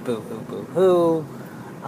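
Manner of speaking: slow, soft mock sobbing; drawn-out sarcastic boo hoo; fake crying. Mocking own sad complaints.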